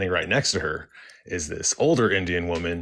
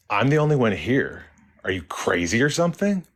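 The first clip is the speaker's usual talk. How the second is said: higher-pitched, imitated indignant clerk; retold scornful challenge, pitched up; indignant retort; 4 semitones higher, 4 dB louder than usual. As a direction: act out her affronted disbelief. Scowling clerk retorts to confusing question.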